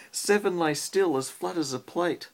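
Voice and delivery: rhythmic storybook read-aloud; reading to a child. Acted. Parent reads rhyming robot book to child, lilting read-aloud. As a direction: read the rhyme to a little one at bedtime.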